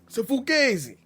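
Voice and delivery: hammed-up Italian mobster repeating fugazi; Italian mobster accent. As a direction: ham up the Italian mobster line. Echoing the famous movie line.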